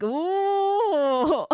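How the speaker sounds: long, high, loud "oooh"; mock ghostly ooh. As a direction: draw out a hammy wailing ooh. Mock dramatic excuse.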